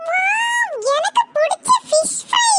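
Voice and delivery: high, excited exclamation; delighted child. Acted. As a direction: squeal over a favourite treat. Child spots favourite fried fish. (குதூகலமான குழந்தைக் குரல்)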